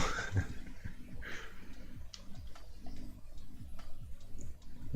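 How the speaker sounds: long soft laugh, mostly breath; quiet real giggle away from the mic. Real, not acted. Laughing at Snoopy comment.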